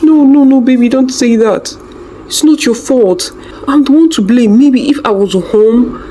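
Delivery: husband comforting crying wife; distressed pleading. A narrator's reading. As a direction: beg her to stop blaming herself.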